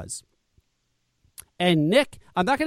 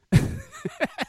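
high, scornful burst of laughter; scoffing laugh. Real, not acted. Reacting to rival's message with a scoffing high laugh. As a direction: laugh off the silly logic.

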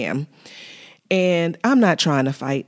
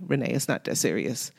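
much softer, lower calming line; soft husband echo; 6 semitones lower, 9 dB quieter than usual. Retold or imitated. Husband calming his wife down, much softer and lower. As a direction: hush it down like a husband soothing. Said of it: "that's usually what he'll tell me".

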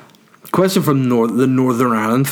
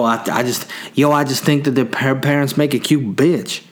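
normal talking voice

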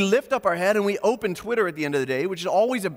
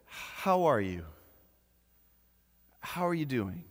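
sincere, caring question; 4 semitones lower, 6 dB quieter than usual. Shown as a demonstration. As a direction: look them in the eye and ask gently. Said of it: "ask you the same question in a different way".

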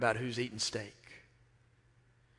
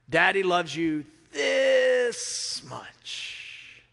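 tender bedtime whisper; 5 semitones higher, 4 dB louder, much slower than usual. Retold or imitated. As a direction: linger on every word like tucking in a child. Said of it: "I would say to them".

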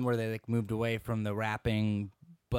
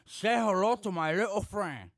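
louder, higher Tony Montana line; Scarface impression; 6 semitones higher, 6 dB louder than usual. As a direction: growl it like Scarface. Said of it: "I could do Scarface".

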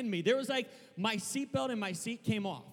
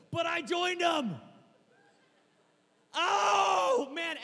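fired-up shout; 10 semitones higher, 6 dB louder, much slower than usual. Acted. Preacher getting fired up.

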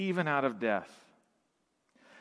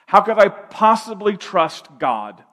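higher, louder cry of a doubter who trusts no one; doubter's protest; 5 semitones higher, 8 dB louder than usual. Retold or imitated. To the congregation, voicing a doubter: loud incredulous protest. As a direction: throw out the skeptic's question.

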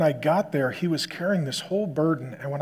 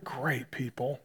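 whisper; 3 semitones lower, 8 dB quieter, slower than usual. Real, not acted. Soft aside about the couple.

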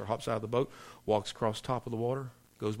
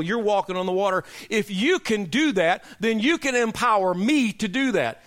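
raised, louder pulpit voice, driving the point home; preaching at full force; 9 semitones higher, 6 dB louder than usual. Acted. Preacher warning the church about faith-draining people, louder and raised. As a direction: preach it hard to the congregation. Preaching on Peter leaving the boat.

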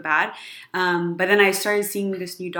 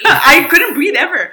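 higher, louder, slow and laughing; 8 semitones higher, 9 dB louder, slower than usual. Real, not acted. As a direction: laugh it out to friends. Laughing about not breathing.

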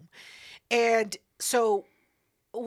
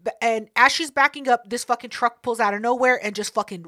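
usual speaking voice

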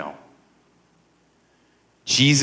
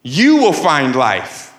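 bold preaching proclamation, key words drawn out; Jesus boldly promising life; bold, rightful declaration; 8 semitones higher, slower than usual. Acted. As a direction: declare it slowly, pitched high, with authority. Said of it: "he can boldly and radically and rightfully say".

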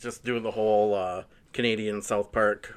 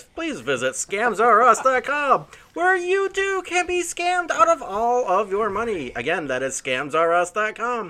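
high, slow announcer pitch; 9 semitones higher than usual. Pretend. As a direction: sell it like a cheesy commercial. Mock ad announcement.

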